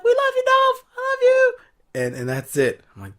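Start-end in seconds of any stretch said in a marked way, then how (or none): 0.0-1.5 very high, loud gushing fan voice; mock fan adoration. Reviewer pretending to be a gushing fan calling to Dolph, very high. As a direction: gush like a screaming fangirl.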